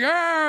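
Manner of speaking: high, loud commercial yelp; a hammed-up scream from a TV ad; mock scream. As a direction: yelp like the panicked ad guy. Mocking a soda commercial.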